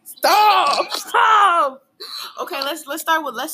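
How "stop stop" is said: loud laughing stop; laughing shout. Real, not acted. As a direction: yell it through laughter. Laughing, begging friend to stop.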